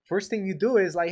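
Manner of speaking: usual speaking voice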